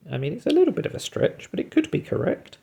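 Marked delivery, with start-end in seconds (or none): none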